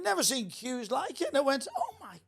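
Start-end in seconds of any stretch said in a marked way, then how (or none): 1.8-2.2 high, soft mock awe; hushed amazement. Comedian retelling his thoughts on seeing the queue, hushed amazement. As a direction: breathe it out softly, pitched right up.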